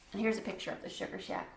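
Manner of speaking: whispered aside to the listener. Real, not acted. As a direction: breathe it, no voice at all.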